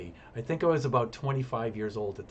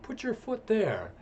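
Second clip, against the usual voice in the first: calling up instructions; 6 semitones higher than usual. Retold or imitated. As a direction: shout guidance to a nervous climber. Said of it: "I'm instructing her".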